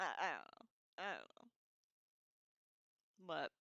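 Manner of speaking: ordinary delivery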